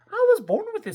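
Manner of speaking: high, loud seal reply; Sammy voice. Acted. Sammy answering the lady about his fur coat. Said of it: "said Sammy".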